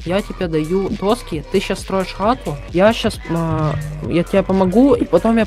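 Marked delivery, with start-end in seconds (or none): none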